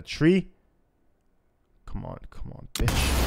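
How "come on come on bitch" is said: whispered urging under the breath; tense whisper. Real, not acted. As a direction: whisper it, willing it to happen. Tense moment in game.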